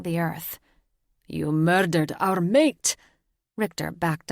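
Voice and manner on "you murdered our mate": slow, loud, grief-stricken accusation; howled accusation. Acted. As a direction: roar it at the killer. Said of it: "Jovan howled again".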